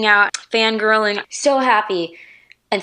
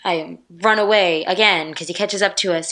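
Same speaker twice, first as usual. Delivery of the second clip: ordinary talk, nothing marked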